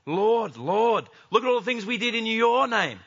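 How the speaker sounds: a loud hammed-up plea to be let in; loud pleading. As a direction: call out eagerly, proud of their deeds. Religious people pleading to Jesus.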